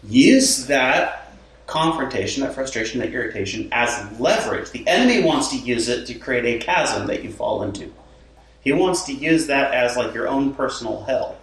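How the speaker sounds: usual speaking voice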